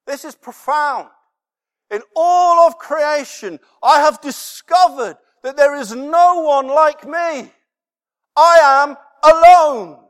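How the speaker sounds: loud, high, climbing sermon voice; preaching at full force. Acted. Preacher to congregation, building to a loud peak. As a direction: drive the point home from the pulpit.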